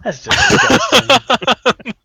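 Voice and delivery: slow, high, loud disgust. Real, not acted. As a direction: drag out the revulsion. Disgust at the paste.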